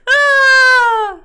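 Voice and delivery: a high, loud laughing shriek; high delighted squeal. Real, not acted. Streamer squeals at a funny chat message. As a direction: burst out in a squeal.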